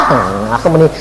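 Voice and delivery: normal talking voice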